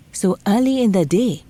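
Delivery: ordinary delivery, nothing marked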